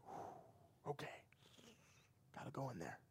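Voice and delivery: very soft, breath let out first; nervous self-talk. Retold or imitated. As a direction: psych yourself up under your breath. Dreading the talk with dad.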